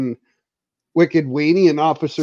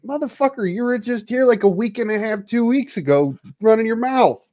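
pitched-up mock outburst at a liar; higher-pitched mock rant; exasperated retort; 7 semitones higher than usual. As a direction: call him out, half-laughing indignation. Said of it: "I'm like".

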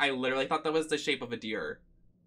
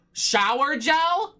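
shower gel? squeaked much higher, slow and mocking; mock incredulous; 11 semitones higher, 5 dB louder, much slower than usual. Real, not acted. Streamer mocks the game's word for body wash, high scoffing. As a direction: scoff at the fancy word, pitch way up.

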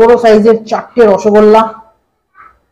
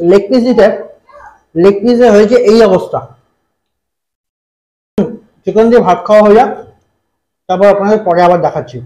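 speaking between bites; talking while chewing rice. Real, not acted. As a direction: mouth partly full of food. Seen 2: holds rice plate, hand at mouth, cheeks bulge while talking.

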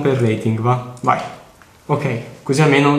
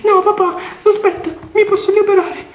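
put-on much higher, squeaky little boy pleading with dad; cartoonish child voice, much higher; high kid voice. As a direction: play the boy in the game in a high voice. Voices the kid character again.

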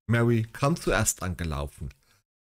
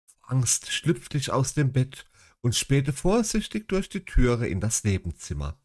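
usual speaking voice

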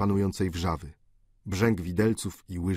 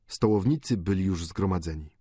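ordinary delivery

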